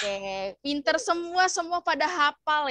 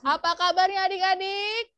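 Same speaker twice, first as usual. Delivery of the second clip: more enthusiastic greeting; 6 semitones higher, 3 dB louder than usual. Shown as a demonstration. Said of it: "Lagi, lebih semangat lagi".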